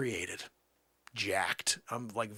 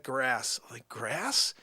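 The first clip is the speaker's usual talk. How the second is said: "Grass?" pitched up with a rising questioning end; incredulous echo; 4 dB louder, much slower than usual. Acted.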